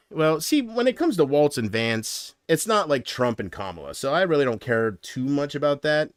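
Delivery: usual speaking voice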